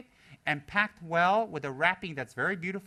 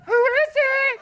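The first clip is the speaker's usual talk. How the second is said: very high, loud call; mock voice behind door; 12 dB louder, much slower than usual. Person behind the locked door calls out, very high and loud.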